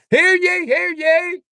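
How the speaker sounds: high, loud mock herald crying hear ye; hammed-up town crier announcing; town crier call.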